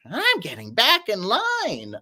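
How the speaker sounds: high, drawn-out cartoon voice; SpongeBob line. Finishing a SpongeBob quote for the chat, high cartoon voice. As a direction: put on a slow, high cartoon punchline. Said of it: "What's the SpongeBob line?".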